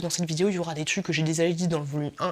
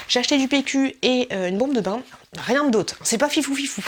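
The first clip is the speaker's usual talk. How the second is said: higher, louder haul-video voice; mock vlogger; 6 semitones higher, 4 dB louder than usual. Vlogger showing a modest haul, mock presenter voice. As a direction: list the buys in a put-on vlogger lilt. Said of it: "J'ai lu ça dans plein de vlogs".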